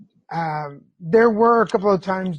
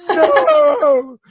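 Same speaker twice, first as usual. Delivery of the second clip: high, loud, long mock wail; wailed refusal; 9 semitones higher, 10 dB louder than usual. Patient told by partner to go to bed, wailed refusal. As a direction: cry out in hammed-up protest.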